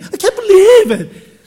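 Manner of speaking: exaggerated wail, loud, high and drawn out; crying man. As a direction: howl it slowly in mock despair. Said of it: "and he's crying".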